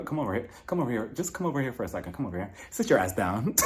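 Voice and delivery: usual speaking voice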